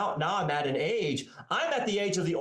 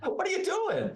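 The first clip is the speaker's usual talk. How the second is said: mock exasperation; 7 semitones higher than usual. Mocking older professors' nostalgia.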